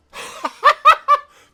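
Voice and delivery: very loud, high burst of laughter; big, high elated laugh; triumphant laugh. Real, not acted. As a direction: cackle with shocked victory. Unexpected victory in the game.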